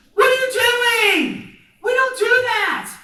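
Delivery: high, loud, drawn-out scolding; appalled teacher shout. Retold or imitated. Teacher catching student writing on the wall, appalled high shout. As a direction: re-enact a horrified yell at a misbehaving pupil.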